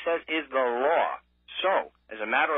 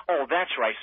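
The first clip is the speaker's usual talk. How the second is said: ordinary talk, nothing marked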